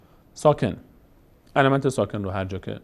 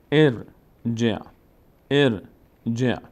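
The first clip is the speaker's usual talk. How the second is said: «ارجع» slowly split as er, then je with ayn; two measured beats in ارجع; slow syllabic reading; much slower than usual. Shown as a demonstration. Teacher putting the word together, slow syllables. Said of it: "باز نیاز داره به کسرهٔ جیم".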